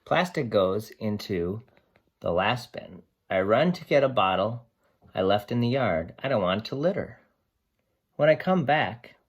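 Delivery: lively picture-book read-aloud; reading to children. Acted. Teacher reading Sam the dog's story to her class, lively and expressive.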